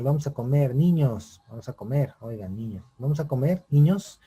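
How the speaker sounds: end-weighted call; emphasis at the end. Shown as a demonstration. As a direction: call them, saving the push for the last niños. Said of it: "la expresión está al final".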